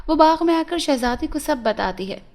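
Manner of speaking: usual speaking voice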